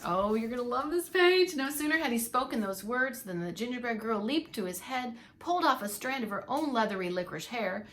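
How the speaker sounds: plain reading voice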